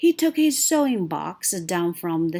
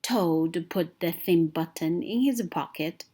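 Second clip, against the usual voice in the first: ordinary delivery, nothing marked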